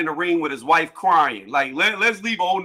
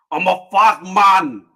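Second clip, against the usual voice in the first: slow, louder gloating boast, stretching each word; gloating taunt; 5 dB louder, slower than usual. Retold or imitated. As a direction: rub it in like a taunting champion. Mocking Fury's taunt to Klitschko.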